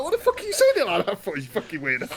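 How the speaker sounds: high, fast, loud mock disbelief; retold incredulity. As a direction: demand it in baffled protest. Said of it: "and I was like".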